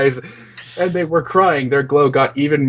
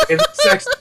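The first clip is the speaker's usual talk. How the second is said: laughing. Real, not acted. Reader laughs mid-sentence.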